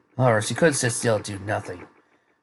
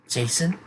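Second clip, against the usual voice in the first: JASON raised high with a rising end; questioning; 7 semitones higher than usual. A narrator's reading. Yang asking about the name she just heard, JASON rising as a question. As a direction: say JASON as a higher, surprised question. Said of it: "asked Yang".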